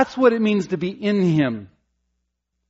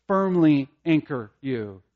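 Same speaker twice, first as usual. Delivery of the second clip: ordinary delivery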